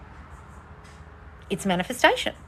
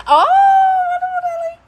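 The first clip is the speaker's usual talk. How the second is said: much higher oh, wonderful, darling; gushing delight; 7 dB louder than usual. Real, not acted. Host gushes at a viewer's engagement news. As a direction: gush over the good news.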